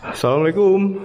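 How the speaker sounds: loud high greeting, echoing; loud calling. Real, not acted. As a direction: call it out to test the echo. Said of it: "suara gua menggema di sini".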